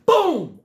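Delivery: loud, high boom as he kicks the can; a loud mock crash noise; boom sound effect. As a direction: punch out a cartoon crash. Kicking the garbage over.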